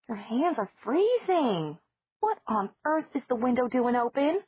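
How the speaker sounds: a lightly voiced worried aunt; fussing aunt. Aunt Judith fusses over Margaret's cold hands, high worried voice.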